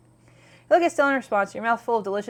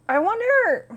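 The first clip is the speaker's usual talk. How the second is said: excited; 12 semitones higher, 6 dB louder, slower than usual. Real, not acted. Excited reaction to game choice.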